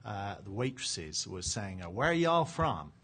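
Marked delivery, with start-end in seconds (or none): 1.9-2.9 higher, slow Texan drawl, imitating a waitress; Texas-accented mock question, pitched up and drawn out; attempted Texas accent. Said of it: "the last I'll try the accent on".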